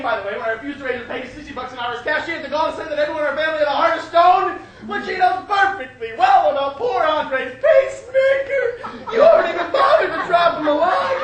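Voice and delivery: loud outburst building to a shout; hammed-up maternal tirade at full volume; ranting mother. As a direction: gather speed and volume in outrage. Mother rants at son.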